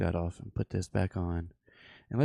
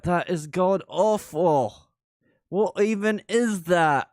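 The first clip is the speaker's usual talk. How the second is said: imitating a disgusted listener; 8 semitones higher, 3 dB louder, slower than usual. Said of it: "She's probably like".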